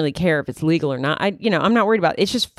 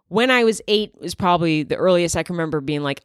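ordinary delivery

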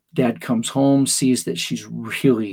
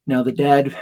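ordinary delivery

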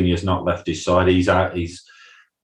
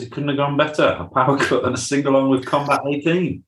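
dry sarcasm, pitched higher; sarcastic; 7 semitones higher than usual. Retold or imitated. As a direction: say it like it went terribly. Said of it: "Brian sarcastically says".

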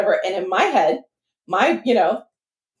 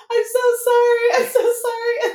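high, teary mock sob of sorry; crying apology; 13 semitones higher than usual. Mom apologizing to police after false alarm, high teary voice. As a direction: plead it through pretend tears, much higher. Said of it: "I was up there crying".

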